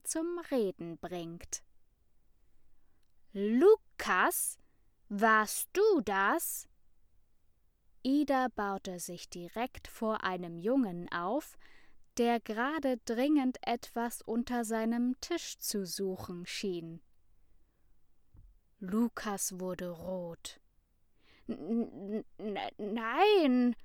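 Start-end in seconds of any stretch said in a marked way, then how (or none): 3.3-6.7 loud, slow stern demand; stern interrogation. A narrator's reading. Ida grills Lukas like a detective, stern and loud. 21.5-23.8 stuttering. A narrator's reading. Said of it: "verteidigte er sich stotternd".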